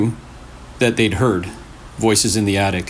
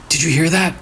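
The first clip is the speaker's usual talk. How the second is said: frightened question; 6 semitones higher, faster than usual. A narrator's reading. Marcus freezes in fear.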